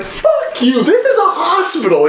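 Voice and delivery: high-pitched, amused angry imitation; mock outrage. Friend fending off press in hospital, mock outraged. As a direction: scold in a high, worked-up voice.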